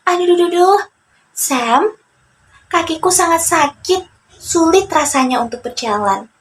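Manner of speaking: pained little character voice; Joni in pain. Acted. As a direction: groan and beg for a wait. Joni complains of sore foot.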